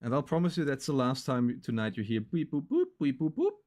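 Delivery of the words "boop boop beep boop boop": beeping noises. Retold or imitated. Imitating raid alert beeps.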